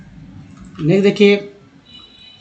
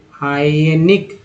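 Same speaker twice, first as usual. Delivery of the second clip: हाइनिक said very slowly, rising at the end; slow model take; much slower than usual. Shown as a demonstration. Teacher repeating the word slowly for learners. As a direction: spell out हाइनिक slowly by ear.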